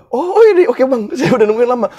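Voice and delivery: relieved excitement. Retold or imitated. Adit greeting his host after waiting, high excited relief. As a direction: burst out in high relief after the long wait.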